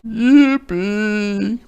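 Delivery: high, loud bray; high loud goat bray. A narrator's reading. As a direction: bray it with glee. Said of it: "brayed Goat".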